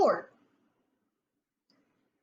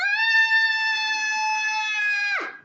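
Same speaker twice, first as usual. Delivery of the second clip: put-on very high, loud child scream; a girl screaming at trolls; terrified scream; 5 dB louder than usual. A narrator's reading. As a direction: shriek in fright. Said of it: "screamed Penelope".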